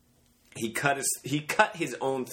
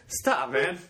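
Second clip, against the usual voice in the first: ordinary talk, nothing marked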